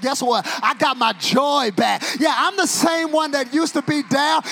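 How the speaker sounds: loud, high, sing-song preaching; whooping preacher at full volume; full-force preaching. Acted. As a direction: drive the crowd to praise.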